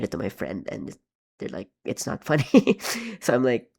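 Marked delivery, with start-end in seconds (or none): 1.8-2.8 higher, louder quote breaking into a laugh; amused retelling, laugh bursting out at the end. Retold or imitated. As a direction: retell it while cracking up. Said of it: "I'm laughing because".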